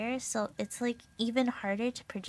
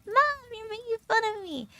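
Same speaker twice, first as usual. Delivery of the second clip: high, loud wail of embarrassment; hammed-up squeal, then high complaint; mock whiny protest; 11 semitones higher, 7 dB louder than usual. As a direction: protest like a teased daughter. Teased daughter protests to mom.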